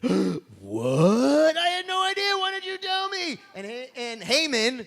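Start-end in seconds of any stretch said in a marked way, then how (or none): 0.0-3.4 high astonished What, staying high; hammed-up high shock; shocked king.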